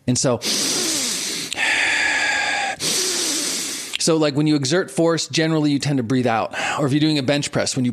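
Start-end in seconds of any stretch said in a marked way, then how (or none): 0.4-3.9 long audible sigh out; slow demonstrative exhale; breath demo. Shown as a demonstration. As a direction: let a full breath out as a demonstration. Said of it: "we're gonna add breath".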